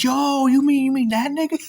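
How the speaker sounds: high, loud mock disbelief; acted astonishment. Storyteller replaying his reaction to who the stranger was, astonished. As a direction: replay your shock, voice leaping way up.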